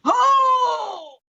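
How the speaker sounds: loud high cry of dismay; anguished cry. Real, not acted. Player crying out loudly at a terrible dice total. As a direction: wail at the failed roll. Player reacts to a bad roll.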